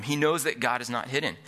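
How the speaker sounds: normal talking voice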